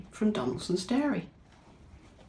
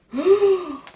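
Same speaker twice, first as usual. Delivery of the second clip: high sharp playful gasp; mock gasp; 13 semitones higher, 10 dB louder than usual. Reading to a child about the scary cat, mock frightened gasp. After naming Scarface Claw.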